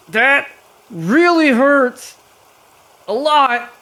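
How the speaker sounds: loud, high cry of pain; pained outburst. Acted. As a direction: yell it out high as the damage lands. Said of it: "as he takes the damage and just goes".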